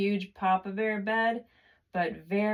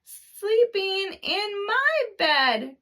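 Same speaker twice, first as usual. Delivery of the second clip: much higher, slow crying cub; Baby Bear's tiny voice; 14 semitones higher, 4 dB louder, slower than usual. A narrator's reading. Narrator voices Baby Bear crying about his bed, high and slow. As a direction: wail it like a tiny upset bear. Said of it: "cried Baby Bear".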